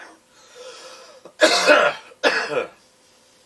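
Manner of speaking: loud hacking cough fit; smoker's cough. Real, not acted. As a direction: hack hard, chesty and rough. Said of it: "Excuse the cough, I smoke".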